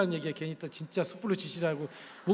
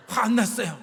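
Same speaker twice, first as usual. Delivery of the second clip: loud, mock-angry insistence; angry denial; 3 semitones higher, 7 dB louder than usual. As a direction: act out someone loudly denying their obvious anger. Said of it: "엄청난 거죠".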